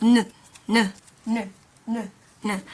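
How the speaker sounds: N voiced as a short "nu"; N sound demo. Shown as a demonstration. Teacher showing how the N letter is pronounced, N sound demo. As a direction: repeat N as separate clipped "nu" syllables. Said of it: "it is pronounced as".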